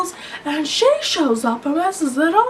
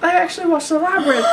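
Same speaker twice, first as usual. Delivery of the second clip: ordinary talk, nothing marked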